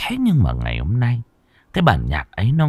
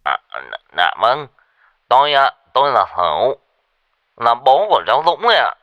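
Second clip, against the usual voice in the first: stammering; 3 semitones higher, 3 dB louder than usual. Acted. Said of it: "lắp bắp".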